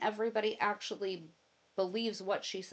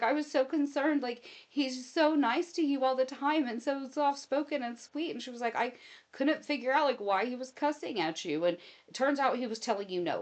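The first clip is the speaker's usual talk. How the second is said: higher, lively mother voice; relieved laughing mom; 6 semitones higher, 3 dB louder than usual. Retold or imitated. Mom telling daughter she was worried, amused relief. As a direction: mom explaining her worry.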